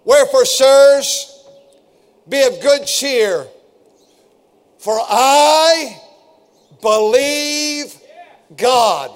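drawn out, weighty, each phrase punched; "Wherefore, sirs" stretched slow and loud, "believe" lifted high; slow scripture proclamation. Shown as a demonstration. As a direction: lean into every word of the verse. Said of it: "Will you say that?".

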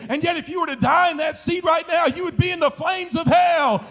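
high-pitched, driving revival preacher; urgent hellfire preaching. Real, not acted. Preaches at full force.

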